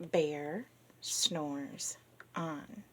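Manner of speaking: slow, soft and drawn out; slowed right down. Shown as a demonstration. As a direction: stretch each word, slowly and gently. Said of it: "my voice slow down".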